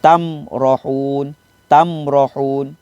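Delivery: tamrohun slow, mim said clearly without nasal hum; slow recitation. Shown as a demonstration. Ustaz demonstrating izhar syafawi, slow recitation. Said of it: "Mim mati jelas bunyi mim mati".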